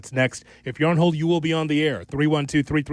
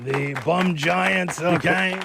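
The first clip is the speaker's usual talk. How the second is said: mock New York accent on the bum Giants, drawn out slowly; 4 semitones higher, much slower than usual. Host hamming a New York accent for his co-host. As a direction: lean into a put-on Brooklyn drawl. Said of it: "putting on the New York accent".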